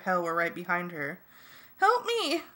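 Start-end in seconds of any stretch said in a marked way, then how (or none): 1.8-2.4 high-pitched cry for help; frightened squeal; screamed plea. A narrator's reading. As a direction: call out high in panic. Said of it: "she screamed".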